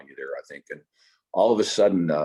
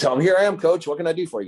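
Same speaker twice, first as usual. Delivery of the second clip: eager reply; 11 semitones higher, 4 dB louder, faster than usual. Retold or imitated. Bruce eagerly answering the coach.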